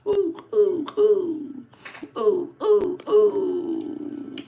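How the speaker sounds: high, airy cooing oohs. Pretend. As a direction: coo up high in wonder. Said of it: "cooing at the box".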